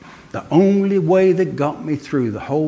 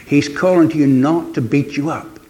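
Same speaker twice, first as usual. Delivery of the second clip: ordinary delivery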